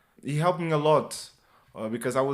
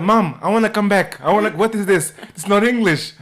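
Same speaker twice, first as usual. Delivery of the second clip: high, loud, fast plea through laughter; begging to go home, half laughing; laughing mock plea; 9 semitones higher, 14 dB louder than usual. Son in Liverpool begs mom to come home, laughing plea. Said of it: "I'm like".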